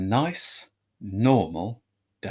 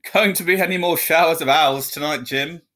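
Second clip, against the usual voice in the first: newsreader banter; 4 semitones higher, 4 dB louder than usual. A narrator's reading.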